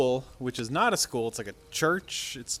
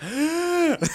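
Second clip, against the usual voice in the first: sharp, high gasp; acted-out gasp of alarm; shocked gasp; 4 dB louder than usual. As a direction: gasp in mock shock at what's on screen. Acting out the assistant's shocked reaction.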